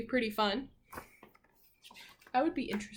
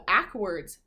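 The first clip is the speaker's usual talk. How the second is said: usual speaking voice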